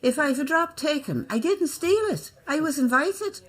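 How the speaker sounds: high, bright stage-maid voice, pitched well up; Irish maid's line. Acted. Actress playing the maid, delivering her line on stage. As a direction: play the maid defending herself, up high. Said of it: "I had to say".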